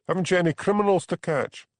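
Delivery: pitched high and sharp; snappish. A narrator's reading. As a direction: throw it back at him. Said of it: "he snapped".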